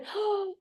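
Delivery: high, soft surprised gasp; mock gasp of delight; sharp gasp of wonder. Athlete gasping as her body opens up, sharp and high.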